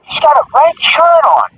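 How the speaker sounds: high, slow police radio call; imitated cop report, drawn out and high; retold radio voice. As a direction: spell out the sighting like a cop on the radio. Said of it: "I hear the radio's coming".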